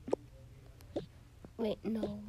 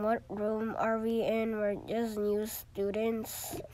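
ordinary talk, nothing marked

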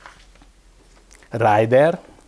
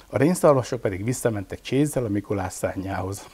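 ordinary delivery

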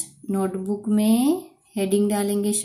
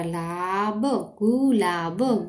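Gulab drawn out slowly; sounding out slowly; much slower than usual. Shown as a demonstration. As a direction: stretch gulab syllable by syllable.

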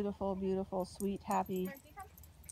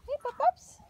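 higher, louder call; puppy calling voice; 12 semitones higher, 6 dB louder than usual. Real, not acted. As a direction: chirp to the pups. Calling puppies.

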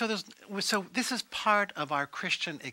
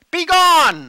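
high, very loud shout; explosive preacher's battle cry; roaring command; 10 semitones higher, 13 dB louder than usual. Acted. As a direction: roar it at the devil to banish him. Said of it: "he roars at Satan and says".

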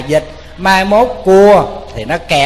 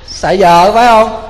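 teasing question; 5 semitones higher, 7 dB louder, slower than usual. Acted. Teasing the audience about henpecked husband.

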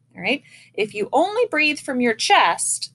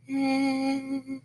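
"Eh" thin, pinched and much softer; thin unsupported; 2 semitones higher, 8 dB quieter than usual. Shown as a demonstration. Band teacher shows the thin, unsupported "Eh" sound. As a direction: let "Eh" come out weak and pinched. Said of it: "instead of like".

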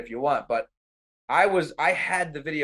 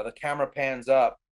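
usual speaking voice

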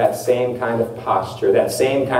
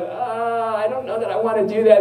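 reluctant hesitation; 8 semitones higher than usual. Acted. Believer balks at what God asks, reluctant. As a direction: act out squirming reluctance. Voices reluctance at God's demand.

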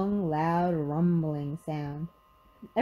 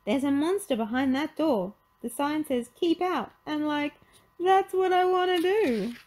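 high-pitched alarmed cartoon voice; scared sidekick, pitched way up; Shaggy; 9 semitones higher, 4 dB louder than usual. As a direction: report it high and worried, rising at the end. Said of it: "Shaggy said".

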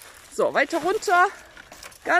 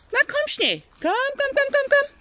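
calling hens; 13 semitones higher than usual. Real, not acted. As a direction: chant komm high and bright to call the flock.

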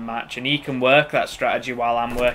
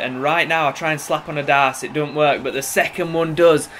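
usual speaking voice

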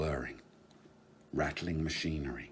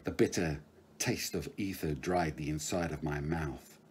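ordinary delivery, nothing marked

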